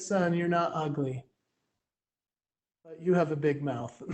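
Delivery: soft, low imitation of a mom; matter-of-fact mom. Mom answering her upset son, soft low deadpan voice. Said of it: "And she said".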